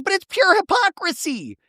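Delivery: high, pinched imitation; mock Shannon outrage. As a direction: go up high and prissy on it. Shannon accusing Tamara, retold.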